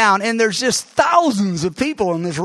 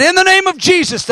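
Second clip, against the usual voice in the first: high, ringing command of faith; bold declaration; 10 semitones higher than usual. Retold or imitated. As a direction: proclaim it with full authority. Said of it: "And I said".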